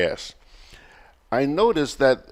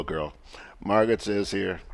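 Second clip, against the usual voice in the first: usual speaking voice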